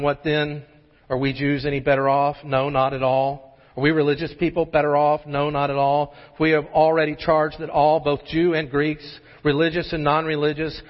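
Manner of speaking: scripture reading. Acted. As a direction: read the verses deliberately to the congregation.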